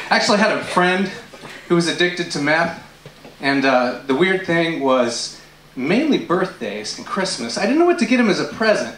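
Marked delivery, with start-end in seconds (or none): none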